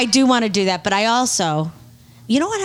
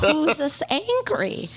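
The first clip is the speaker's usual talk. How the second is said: slow exasperation; 7 semitones higher, much slower than usual. Real, not acted. Exasperated, slowed.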